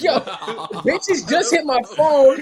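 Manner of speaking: high, loud, stretched-out protest breaking into laughter; delusional insistence. Retold or imitated. Inmate telling guards about his phone, hammed-up insistence. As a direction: insist on it, cracking up.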